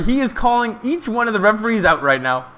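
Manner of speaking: usual speaking voice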